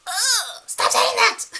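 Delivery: fed-up wife yelling at her husband; exasperated shout. Retold or imitated. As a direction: blow up at him, half laughing. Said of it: "She just got sick of it".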